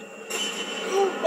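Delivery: loud, sharp intake of fright; high frightened gasp; startled gasp. Real, not acted. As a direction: gasp in real shock. Player startled by horror game.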